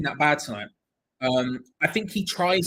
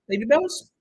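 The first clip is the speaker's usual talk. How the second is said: higher, louder disbelieving ask; higher incredulous question about the cheese; 10 semitones higher, 5 dB louder than usual. Real, not acted. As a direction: ask it like it's obvious.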